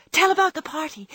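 high, loud, eager cry from sleepy little sisters. A narrator's reading. Little sisters in nightcaps begging Jo and Meg, eager loud cry. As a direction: call out eagerly for the story. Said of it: "two sleepy but eager voices cried out".

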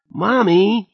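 put-on high, loud child's wail; upset girl crying for Mommy. A narrator's reading. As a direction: wail for mommy like a four-year-old. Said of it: "she wailed".

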